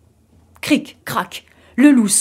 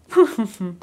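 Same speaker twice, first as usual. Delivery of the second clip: short, restrained chuckle; small acted villain's chuckle; lesser wolf laugh. A narrator's reading. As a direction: laugh as the wolf, but less sure of it. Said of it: "Il rit encore, mais un peu moins".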